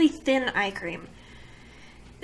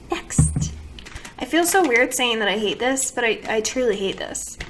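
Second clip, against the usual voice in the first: ordinary talk, nothing marked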